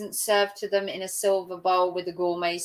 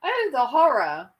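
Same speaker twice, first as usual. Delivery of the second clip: theatrical pretend dismay; mock horror; 3 semitones higher than usual. Mocking entitled Karens over ice cream, mock horror. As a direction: pretend to be appalled by a trifle.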